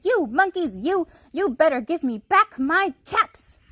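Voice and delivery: high, loud angry demand; quite angry. A narrator's reading. Peddler stamping his foot at the monkeys, loud angry demand. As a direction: stamp your foot and demand them back. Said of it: "Now he felt quite angry".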